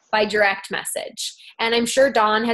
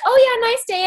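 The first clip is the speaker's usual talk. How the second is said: high, louder bright echo of the greeting; mimicking the clerk; 12 semitones higher, 5 dB louder than usual. Retelling a post office clerk's reply, high mock echo. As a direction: parrot the clerk's reply in a much higher voice. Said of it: "She's like".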